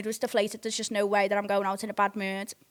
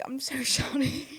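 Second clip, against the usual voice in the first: slow apology broken by a giggle; mock sheepish apology; 3 semitones higher, 5 dB quieter, slower than usual. Boyfriend apologises the morning after, mock sheepish. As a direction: imitate an apology, drawn out and laughing.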